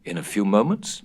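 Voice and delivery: plain reading voice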